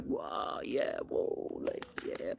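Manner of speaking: whispered crowd cheers; breathy, voiceless whoops; mock party cheering. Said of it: "had the most amazing time".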